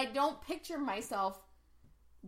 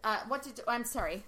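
ordinary talk, nothing marked

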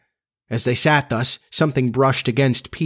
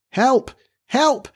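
thin, high distant cry; feeble cry for help; 13 semitones higher than usual. A narrator's reading. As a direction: cry weakly for help, far off. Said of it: "a feeble cry".

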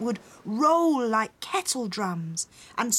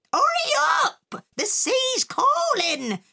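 very high, loud holler; shouting; 4 dB louder than usual. A narrator's reading. Pirate mother waving her cutlass from the wheelbarrow, shouting. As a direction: holler it high, stretching the last words. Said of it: "shouted the pirate mother".

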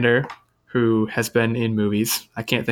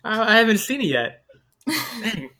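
laughing; 10 semitones higher than usual. Real, not acted. Laughing at the botched trailer.

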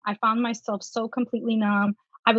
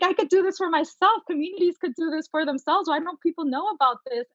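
higher, eager retelling of a big discovery; quoting her own thrilled thoughts, pitched up; retold excitement; 7 semitones higher than usual. Telling the podcast host how tapping thrilled her, raised and eager.

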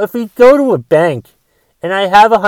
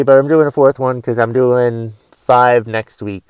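ordinary talk

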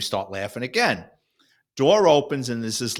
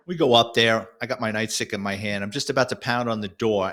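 ordinary talk

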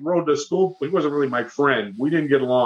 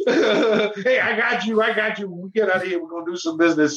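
higher, chummy reassurance, fading; friendly inmate; 4 semitones higher than usual. Retold or imitated. Inmate promising the kid future business, chummy voice. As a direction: promise him business, buddy-buddy.

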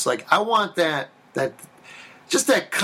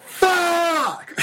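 frustrated yell. Retold or imitated. Alone in the parking lot after the audition, laughing frustrated shout. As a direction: let it all out after the audition.

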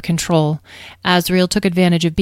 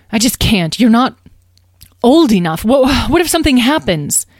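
mildly anxious, louder and higher plea; worried mother; 6 semitones higher, 5 dB louder than usual. Acted. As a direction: stammer a little with a mother's worry. Worried mother refuses children.

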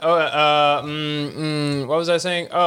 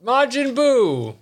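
announcer voice; 5 semitones higher, much slower than usual. Acted. Opening the show's topic.

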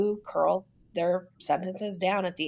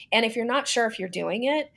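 normal talking voice

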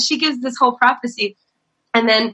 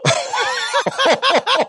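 high laugh; 13 semitones higher than usual. Real, not acted. Retelling the church moment, a high burst of laughter. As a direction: crack up.

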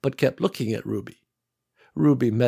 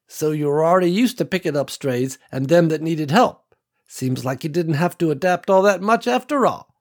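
put-on higher, kindly old country woman; a hint of a warm old rancher woman; old farm woman; 6 semitones higher, 3 dB louder than usual. A narrator's reading. Ruby voiced by narrator.

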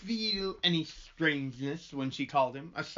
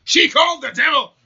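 high, loud gasping shout; shocked shout; 11 semitones higher, 15 dB louder than usual. Acted. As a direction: cry out in horror.

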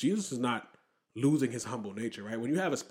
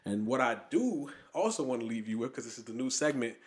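ordinary delivery

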